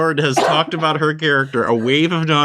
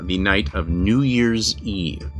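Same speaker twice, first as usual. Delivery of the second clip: story narration; 6 semitones lower than usual. Acted. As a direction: set the scene like a storyteller. GM opens the vampire story.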